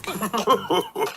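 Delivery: high soft giggle. A narrator's reading. Piglet giggling on the way, high soft giggle.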